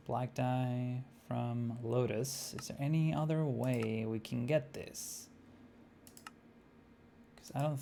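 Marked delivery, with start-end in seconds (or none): none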